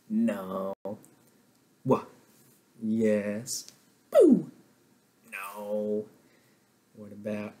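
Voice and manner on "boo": high, loud playful boo; mock boo. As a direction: tease the wrong answer. Teacher boos a wrong answer.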